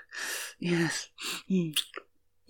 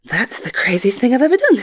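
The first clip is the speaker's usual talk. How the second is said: excited; 5 semitones higher, 10 dB louder, faster than usual. Acted. Giddy afterglow.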